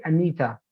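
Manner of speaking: "Anita" opening on a schwa; correct name pronunciation. Shown as a demonstration. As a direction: say Anita as uh-NEE-tuh. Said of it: "pronounce the name in the right way".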